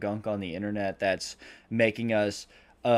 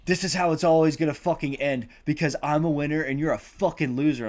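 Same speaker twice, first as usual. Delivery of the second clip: loud, high gloating yell; triumphant snarl; 6 semitones higher, 7 dB louder than usual. Retold or imitated. Emma Stone gloats over the man she is choking, loud triumphant yell. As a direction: spit it out as the winner.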